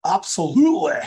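mock Scottish accent, eager. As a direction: blurt it out like a keen Glaswegian. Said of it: "In a new Scottish accent".

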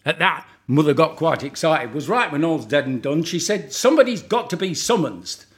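put-on Lancashire monologue in broad northern accent; northern accent recitation. Performs Albert and the Lion poem.

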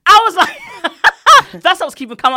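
ordinary delivery

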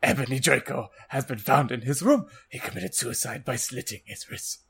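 put-on tearful headmaster, fading off; hammed-up weepy character voice, dying away; Dumbledore, crying. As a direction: announce it with mock-tearful gravity. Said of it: "He started to cry, wisely".

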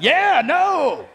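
high, loud mock yell of no; a hammed-up shouted no from the congregation. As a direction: imitate someone shouting their no. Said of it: "I heard somebody yelling like".

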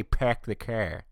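imitated Boston accent, R's dropped in "peck the care". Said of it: "don't even know how to say".

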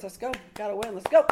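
imitating him yelling encouragement, last words shouted; retold screaming. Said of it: "start screaming at me and screaming at the players".

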